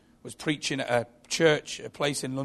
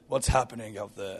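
lightly voiced wise creature, lower pitch; a hint of a sage, pitched down and understated; 5 semitones lower, 4 dB quieter than usual. Gorgonite asks the little boy, lightly put-on wise voice. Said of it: "dead wise voice".

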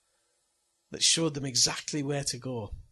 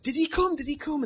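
high-pitched eager questioning; feigned excitement; 12 semitones higher, 6 dB louder than usual. Retold or imitated. Dad asking kids about Santa, high mock excitement. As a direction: act thrilled, pitched right up.

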